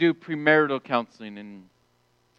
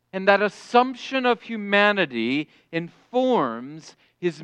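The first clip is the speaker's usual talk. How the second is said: preaching; 4 semitones higher, 4 dB louder than usual. Acted. Preaching at full force.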